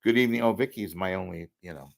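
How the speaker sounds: ordinary talk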